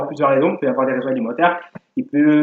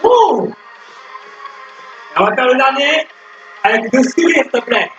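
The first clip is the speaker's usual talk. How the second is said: barking gym coach; 7 semitones higher, slower than usual. Acted. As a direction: pump the class up like a fitness coach. Leads workout over music.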